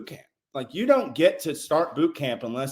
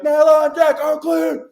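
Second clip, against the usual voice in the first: high, loud chanted reply; shrill loud recruit response; recruits calling back; 13 semitones higher, 8 dB louder, slower than usual. Retold or imitated. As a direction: sing it back like a drilled recruit. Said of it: "And they're like".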